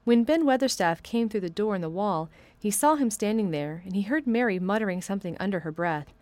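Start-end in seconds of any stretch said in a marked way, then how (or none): none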